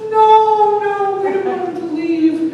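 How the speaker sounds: high, loud, drawn-out protest; wailing child. Retold or imitated. Children begging mother to keep the duck, wailing. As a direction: imitate kids whining in dismay.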